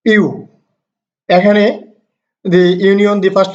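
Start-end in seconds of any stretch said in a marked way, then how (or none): 0.1-0.3 "ইউ" sounded as "you"; pronunciation demo. Shown as a demonstration. Teacher explaining European to learners, demonstrating "ইউ" as "you". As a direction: give the letter E's sound here as "ইউ", like you. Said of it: "ই উচ্চারণটা".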